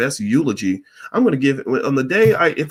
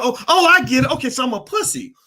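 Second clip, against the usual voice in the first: mock realization; 10 semitones higher than usual. Retelling his comeback to the bully, mock realization. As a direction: play up suddenly getting it, pitched high.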